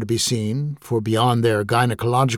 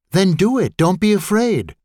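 urging; 8 semitones higher than usual. Acted. Forty-ish colleague urging.

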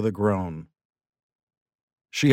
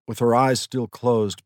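ordinary delivery

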